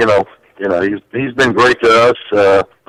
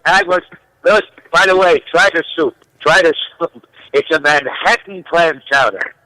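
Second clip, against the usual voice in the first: Billy Crystal; 5 semitones higher than usual. Pretend. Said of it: "You sound a lot like Billy Crystal".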